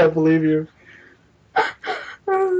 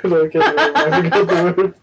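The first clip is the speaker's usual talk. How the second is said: normal talking voice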